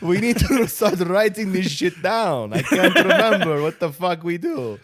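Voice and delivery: put-on Russian accent, cracking up. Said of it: "Where this just Russian accent".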